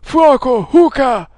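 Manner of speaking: high, loud battle order; shouted command. A narrator's reading. Enemy ordering his companion to shoot, shouted command. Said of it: "gridò una voce".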